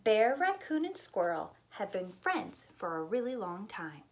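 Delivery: plain reading voice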